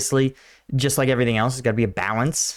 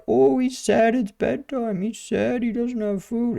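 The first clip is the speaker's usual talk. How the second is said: much higher, whiny doting pet-owner voice; mocking doting owner; 11 semitones higher, slower than usual. Host mocks dog owners who pity their pets, high and whiny. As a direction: mimic a soppy owner fussing over a dog. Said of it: "people that are like".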